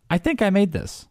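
high, small put-on claim; meme character voice. Host joining co-host's meme bit, mock proprietary. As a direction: play along in a high meme voice.

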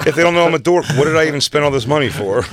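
loud, high whiny star voice; mock vain comic. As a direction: whine like a pampered headliner. Mocking a vain comic's demands.

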